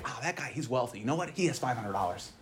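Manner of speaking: very soft, low confiding voice; hushed aside. Acted. Someone remarking on a rich man's wealth, hushed aside. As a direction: drop right down, as if passing on gossip. Voicing belittling praise of billionaire.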